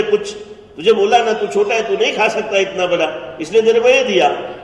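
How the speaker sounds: loud, high scolding father; angry father shouting at his son. A narrator's reading. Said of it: "तो थोड़े गुस्से में आ गए".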